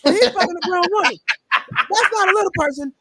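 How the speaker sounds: high-pitched, exasperated comic rant; Bill Burr bit. Retold or imitated. Retelling a favorite comedian's joke, mock exasperation. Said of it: "one of my favorite Bill Burr jokes".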